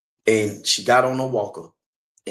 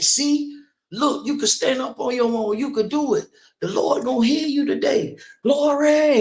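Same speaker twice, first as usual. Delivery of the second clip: much higher, mock preacher crying glory; exhorting preacher; 11 semitones higher than usual. Retold pastor urging granny to stand on her own, high exhortation. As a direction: lift her up like a fiery pastor.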